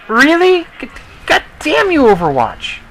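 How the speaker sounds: high exasperated cry at the killstreak; exasperated outburst. Real, not acted. Cursing the Overwatch that got him killed, high and exasperated.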